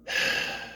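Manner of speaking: long voiceless exhale; weary sigh. Real, not acted. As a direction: sigh before naming someone annoying. Sighs before naming disliked character.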